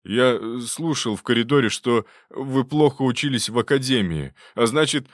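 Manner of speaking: distrustful hunchbacked patient. A narrator's reading. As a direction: object nervously, stumbling into the accusation.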